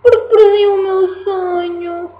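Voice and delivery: high, slow sobbing; sobbing teddy bear. Acted. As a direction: wail it like a heartbroken toy. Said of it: "continuou a chorar".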